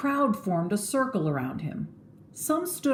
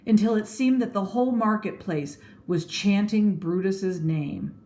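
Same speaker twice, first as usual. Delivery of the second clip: ordinary delivery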